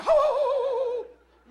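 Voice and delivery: slow high hooting noise. Pretend. Preacher mocks empty praise with slow hoots. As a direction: hoot it out like a silly owl.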